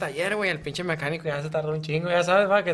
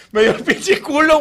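excited retelling; 9 semitones higher, 10 dB louder, slower than usual. Real, not acted. Telling a funny fight story.

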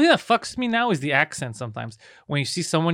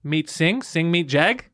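slow deliberate intro; much slower than usual. Pretend. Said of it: "be like".

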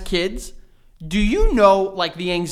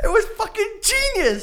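laughing. Real, not acted. Laughing at the bribe story.

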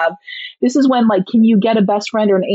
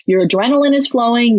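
ordinary talk

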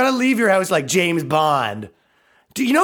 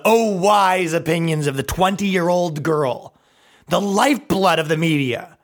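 ordinary talk, nothing marked

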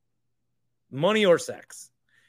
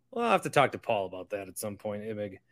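ordinary talk, nothing marked

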